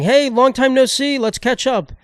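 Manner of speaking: high-pitched fake friendly greeting; mock scam texter. Scam texter greeting a stranger, mock high voice.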